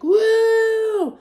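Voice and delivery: loud, high, drawn-out "woo"; high whoop held long and loud; whooping cheer. Retold or imitated. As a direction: imitate a jet-ski rider's joyful whoop. Imitating man whooping on jet ski.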